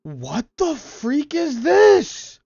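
high, loud, drawn-out outburst; incredulous high-pitched cry; freaked-out disbelief. Real, not acted. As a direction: stretch it upward into a loud yelp. Startled by something in the game.

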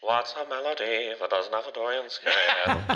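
mock old-timer, half laughing; old-timey voice. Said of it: "Did they say that in, like, an old-timey voice".

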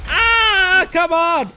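anguished shout. Real, not acted. Gamer swarmed by enemies, anguished shout. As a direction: plead with the game in frustration.

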